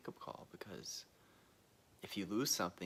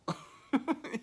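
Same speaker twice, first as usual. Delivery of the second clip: loud burst of laughter; 10 dB louder than usual. Real, not acted. Laughing at own joke.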